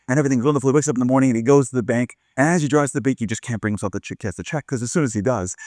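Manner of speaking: plain reading voice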